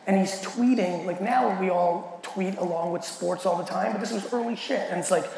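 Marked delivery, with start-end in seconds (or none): none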